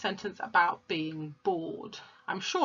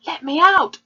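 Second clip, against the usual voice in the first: loud, high pleading cry; small voice pleading; 10 semitones higher, 14 dB louder than usual. Acted. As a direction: cry out high, begging to be freed. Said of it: "a small voice pleaded".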